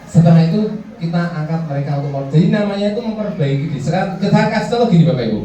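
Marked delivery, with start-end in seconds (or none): none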